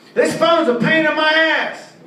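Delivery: high, slow, whiny complaint; whiny caller. Retold or imitated. Caller whines to phone rep about his phone. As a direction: whine like a fed-up customer.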